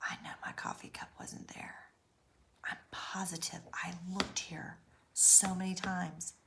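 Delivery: soft whisper, convinced; whispered disbelief. Retold or imitated. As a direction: hush it, insisting to yourself.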